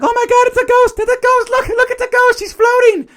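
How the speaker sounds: very high-pitched hysterical babble; Daphne's frantic panic. Acted. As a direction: squeal it in hammed-up excitement. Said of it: "Daphne's like".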